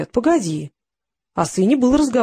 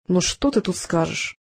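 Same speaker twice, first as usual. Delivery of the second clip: ordinary delivery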